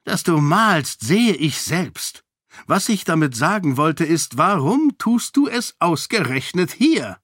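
put-on an irritated old king holding back; exasperated king. King questioning his daughter, exasperated.

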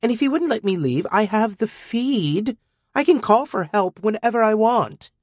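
put-on high, scornful overconfident girl. A narrator's reading. Defiant teen girl lecturing her guardian robot, scornful. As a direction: brush him off, cocky and scornful. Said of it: "She was scornful and way overconfident".

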